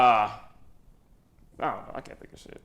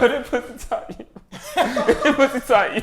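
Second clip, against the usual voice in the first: giggling while reciting a lyric; laughing through lyric; 6 semitones higher than usual. Real, not acted. Friend recalls a rap lyric, laughing through it. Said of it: "How they go?".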